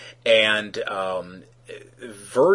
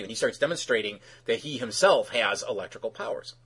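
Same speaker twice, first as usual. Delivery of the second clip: normal talking voice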